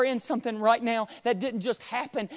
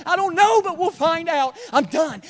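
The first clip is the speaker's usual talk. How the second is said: excited outburst; 10 semitones higher, 10 dB louder than usual. Acted. Excited aside in retelling.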